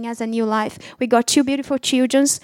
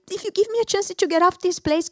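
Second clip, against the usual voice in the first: tearful plea; 6 semitones higher than usual. Retold or imitated. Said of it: "I started crying, and say".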